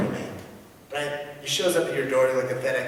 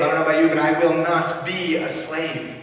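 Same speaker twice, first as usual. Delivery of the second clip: ordinary talk, nothing marked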